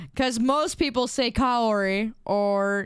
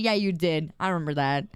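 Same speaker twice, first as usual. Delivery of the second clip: ordinary delivery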